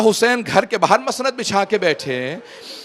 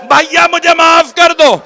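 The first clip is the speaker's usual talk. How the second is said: lamenting preacher; 7 semitones higher, 10 dB louder than usual. Acted. Preacher lamenting the mounting of the women to the weeping congregation, loud wailing recitation. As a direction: pour grief over the mourners at full force.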